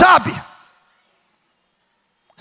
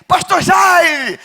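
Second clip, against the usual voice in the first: slow, high, called-out greeting; mock call across the shop; 7 semitones higher, much slower than usual. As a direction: hail the pastor from across the store. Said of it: "greeting in the fruit shop".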